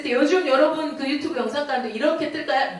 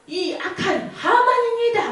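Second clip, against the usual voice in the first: high, pointed accusation; queen denouncing Haman, pitched high; accusing queen; 9 semitones higher than usual. Acted. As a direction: point him out, voice leaping up.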